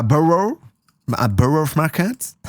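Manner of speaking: "Borough Market" said slowly and haltingly in French-accented English; awkward English place name. Shown as a demonstration. Streamer to chat naming the London market, unsure of the English. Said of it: "Je sais pas comment le dire".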